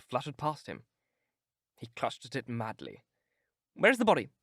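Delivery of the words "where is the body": high, loud urgent exclamation; exclaiming. Acted. Dorian demands to see the dead sailor, urgent exclamation. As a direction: demand it in a rush of hope. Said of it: "he exclaimed".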